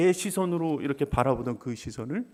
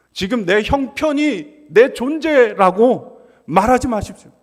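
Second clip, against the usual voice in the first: louder, high, pressing sermon exhortation; preaching at full force; 5 semitones higher, 12 dB louder than usual. Acted. Pastor urging the congregation how to pray, fervent loud preaching. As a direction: drive it home to the congregation.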